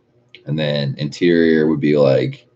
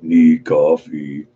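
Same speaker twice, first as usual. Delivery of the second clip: slow, drawn-out zombie groan; zombie moan; 4 dB louder, much slower than usual. Retold or imitated. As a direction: moan it out like the undead. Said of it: "It's like".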